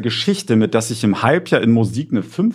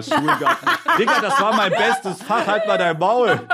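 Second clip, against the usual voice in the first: laughing high; 10 semitones higher, slower than usual. Real, not acted. Gushing over favourite school subject.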